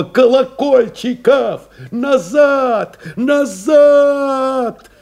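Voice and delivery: high, drawn-out urgent call; командный окрик. A narrator's reading. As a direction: call him back desperately, voice climbing and stretched out. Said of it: "Но команду его заглушило". (протяжный отчаянный крик-команда)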